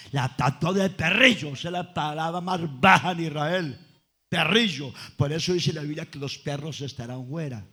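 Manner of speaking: lively storytelling preacher; preaching. Acted. Preacher telling the congregation about the Canaanite woman, preaching. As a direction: voice the disciples and Jesus with fire.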